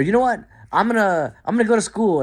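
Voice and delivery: high eager announcement; sudden resolve. Pretend. Talking to yourself, high and eager. Imagined inner voice deciding.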